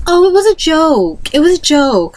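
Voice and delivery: high, slow, dismissive mock girl voice; mock innocent. Bully girl to the counselor, mock innocent excuse.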